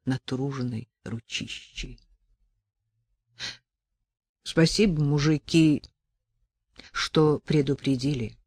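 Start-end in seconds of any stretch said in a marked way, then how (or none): none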